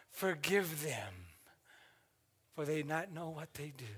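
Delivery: soft forgiving plea. Retold or imitated. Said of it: "he says".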